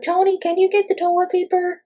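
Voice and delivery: high, airy put-on voice calling to a helper; high pampered voice asking Tony for toilet paper; mock diva request. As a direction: play a pampered star ordering her assistant. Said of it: "It's not like".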